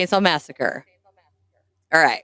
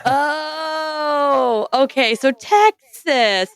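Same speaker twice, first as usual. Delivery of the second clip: dawning realization; 6 semitones higher, much slower than usual. Retold or imitated. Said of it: "and then it clicked".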